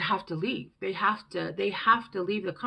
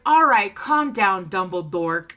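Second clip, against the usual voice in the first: much louder, slow mock scolding; a hammed-up calm down, Dumbledore; James Franco line; 10 dB louder than usual. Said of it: "he's like".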